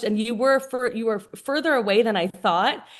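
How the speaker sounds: ordinary delivery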